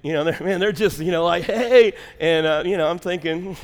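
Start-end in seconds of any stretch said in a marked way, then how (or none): 1.4-1.9 loud, higher greeting call; friendly holler. Retold or imitated. Preacher mimics islanders greeting him, friendly holler. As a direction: holler hello like a waving stranger. Waving strangers call out hello.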